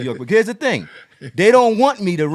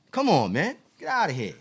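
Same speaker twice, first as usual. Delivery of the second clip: normal talking voice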